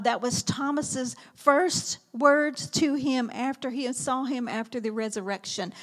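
normal talking voice

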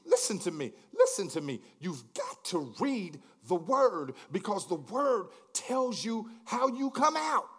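loud, high climactic sermon cry; preaching at full force. Acted. Preacher rising to a roaring climax before his congregation, loud and high. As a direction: build to the peak and shout it out.

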